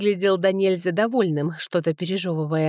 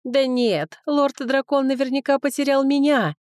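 lightly voiced, higher-pitched woman; woman's voice; 6 semitones higher, 4 dB louder than usual. A narrator's reading. Second woman claiming the dragon lord for herself, higher female voice. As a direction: raise the pitch for a rival woman. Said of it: "послышался ещё один женский голос".

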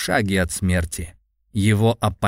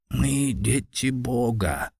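clearly enunciated; 3 semitones higher, 4 dB quieter, slower than usual. A narrator's reading. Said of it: "отчётливо произнёс Ияками Суитира".

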